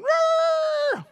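very high, loud mock neigh; whinny like an excited horse. Shown as a demonstration. Preacher pictures a horse for congregation, piercing whinny. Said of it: "like a horse going".

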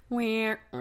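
mock sad wah wah; sad trombone. Mocking her disappointment, a sad wah wah. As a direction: play the deflated sad trombone. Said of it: "she was like".

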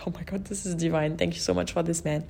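fast hushed whisper of delight; quick delighted whisper. Retold or imitated. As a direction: whisper it quickly, savouring the moment. Delighted inner thought, whispered.

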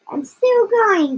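high, drawn-out grandma cry; screechy old voice. A narrator's reading. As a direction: stretch it high, calling from up above. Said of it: "the old screechy voice from up above".

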